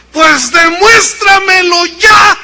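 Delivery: slow, high, loud shout; furious wife finally snapping; fed-up demand. Acted. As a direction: hammer out each word, done with him.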